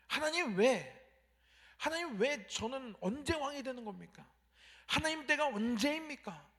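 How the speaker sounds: a defiant demanding cry; defiant complaint. Retold or imitated. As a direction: talk back to God, demanding. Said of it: "하나님께 대들고 불평하고".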